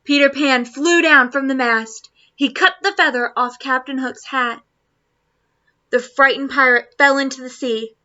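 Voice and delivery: ordinary delivery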